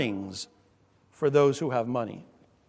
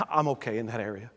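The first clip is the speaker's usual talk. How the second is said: dismissive; 6 semitones lower, 6 dB quieter, faster than usual. Retold or imitated. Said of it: "instinctive reaction".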